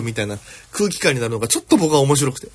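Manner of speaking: usual speaking voice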